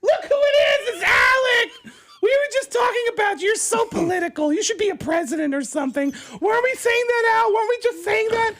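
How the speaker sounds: high excited gushing woman's voice; gushing Beverly D'Angelo. Retold or imitated. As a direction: squeal his name like a starstruck fan. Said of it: "jumps off the elevator and goes".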